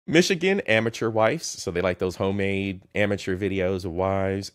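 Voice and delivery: ordinary talk, nothing marked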